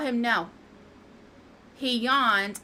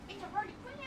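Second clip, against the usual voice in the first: high cooing pet voice; 11 semitones higher, 15 dB quieter, much faster than usual. Real, not acted. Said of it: "Oh, it's a Harley Quinn".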